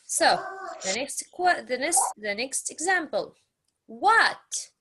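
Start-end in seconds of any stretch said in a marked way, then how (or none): none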